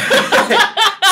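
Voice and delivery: loud high laugh; high burst of laughter. Real, not acted. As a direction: cackle loudly.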